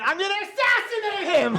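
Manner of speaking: a deaf dad bellowing the threat in a restaurant; deaf dad's loud threat. Retold or imitated. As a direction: blurt it out like you can't hear yourself. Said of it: "But my dad just went".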